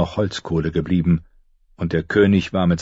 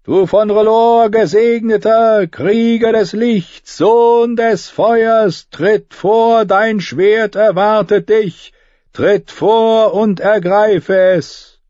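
high, loud and slow summons; raised voice; 6 dB louder, much slower than usual. Acted. Priestess summoning king before the whole army, slow loud call. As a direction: call him forth, ringing out over the army. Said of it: "Sie hob die Stimme".

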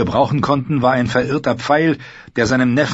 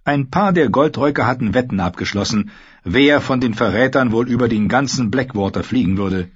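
ordinary delivery

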